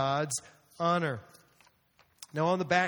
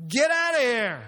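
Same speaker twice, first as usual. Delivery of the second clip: loud, higher commanding shout; Jesus's angry shout; 6 semitones higher, 7 dB louder than usual. Acted. Jesus ordering the money changers out, shouted. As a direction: throw them out of the house.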